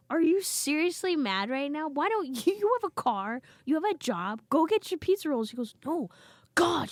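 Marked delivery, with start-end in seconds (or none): 0.1-5.5 high, loud mock disbelief; incredulous scolding. Siblings scold grown brother over snacks, incredulous. 5.9-6.9 high, loud petulant outburst; mock tantrum, exasperated; mad brother. Brother snaps at teasing siblings, mock angry. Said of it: "He was so mad".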